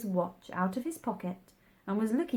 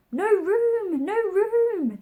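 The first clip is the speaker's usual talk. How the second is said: high, loud chorus cry; 13 semitones higher, 10 dB louder, slower than usual. A narrator's reading. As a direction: shout it out, crowding them off. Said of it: "they cried out".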